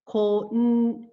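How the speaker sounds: "Konnichiwa" in five separate beats, ko-n-ni-chi-wa; syllable by syllable. Shown as a demonstration. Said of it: "It is a five-syllable word".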